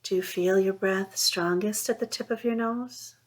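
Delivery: ordinary talk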